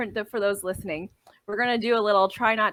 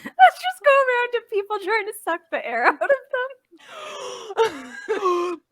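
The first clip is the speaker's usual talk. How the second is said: laughing; 10 semitones higher than usual. Real, not acted. Said through laughter.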